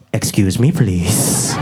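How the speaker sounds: low creepy stranger in the dark; low, out-of-nowhere polite request; deep-voiced man lurking by a bush; creepy park stranger. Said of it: "out of nowhere he just goes".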